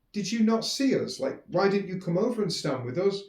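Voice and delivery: can't believe he didn't come over; incredulous. Retold or imitated. Her incredulous question retold.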